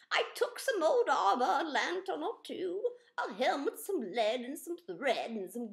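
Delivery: put-on a nervous old tinkerer lamenting; fretful old inventor. Amos explains his monster.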